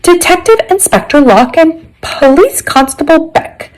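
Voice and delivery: high-pitched, posh butler's announcement; best posh voice, playing butler. A narrator's reading. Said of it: "in my best posh voice".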